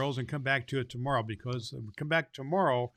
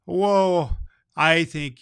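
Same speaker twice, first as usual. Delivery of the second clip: surprised; 5 semitones higher, 11 dB louder, much slower than usual. Real, not acted. Reacting to child's letter.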